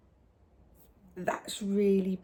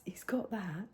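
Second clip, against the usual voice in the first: whispering; 8 dB quieter than usual. Real, not acted. Secretive reveal.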